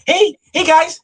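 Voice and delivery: high, sing-song greeting; mock gushing guy. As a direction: sweet-talk the room. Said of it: "used to guys coming in".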